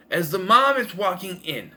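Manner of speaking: usual speaking voice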